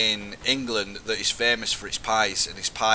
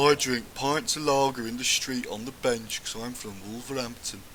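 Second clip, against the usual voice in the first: put-on broad Wolverhampton accent. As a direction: take off a Wolverhampton lass in her broad accent. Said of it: "broad accent".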